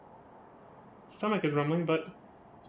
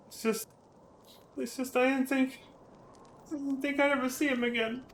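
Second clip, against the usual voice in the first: high, soft, hesitant and close to tears; exaggerated choke-up, pitched high and hushed; acted choking up; 7 semitones higher, 5 dB quieter than usual. Game character overcome at seeing a lost friend again, acted choking up. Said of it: "I'm not actually choking up. A little, but not that much".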